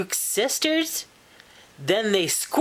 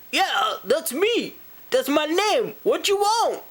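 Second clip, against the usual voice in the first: high, mock-indignant comeback; indignant moose reply; 8 semitones higher than usual. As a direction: answer back with pitch climbing high. Moose retells answering the boy's yell.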